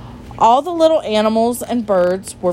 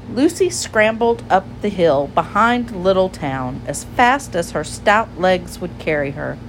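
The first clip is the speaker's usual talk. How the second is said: ordinary delivery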